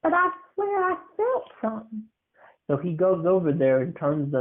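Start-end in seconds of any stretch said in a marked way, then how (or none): 0.0-2.0 put-on high small boy voice, hushed; a nervous kid whispering his doubts; scared little boy. Kid's voice in ghost story.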